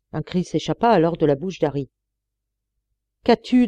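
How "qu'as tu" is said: sharp, high, louder question; quick question. A narrator's reading. Engineer reacting to Harry's cry, asking sharply. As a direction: snap the question out urgently. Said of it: "demanda vivement James Starr".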